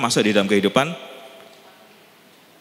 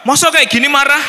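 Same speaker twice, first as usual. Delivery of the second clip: much higher, much louder indignant retort; angry protest; 10 dB louder than usual. Retold or imitated. In-law insisting she isn't angry, loud and high. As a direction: fire it back, offended. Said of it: "Nah itu marah gitu".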